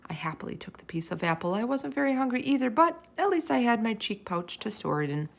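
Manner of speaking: ordinary delivery, nothing marked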